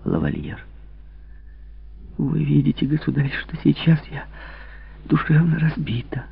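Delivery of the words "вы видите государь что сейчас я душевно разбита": high, hushed and broken; pleading whisper. Acted. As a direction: beg him softly, almost whispering. Louise pleads with the king.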